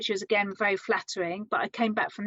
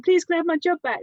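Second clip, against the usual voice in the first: higher-pitched imagined begging; mock pleading; 7 semitones higher, 4 dB louder, faster than usual. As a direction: plead lightly, voice lifted, as if retelling. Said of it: "with a begging bowl saying".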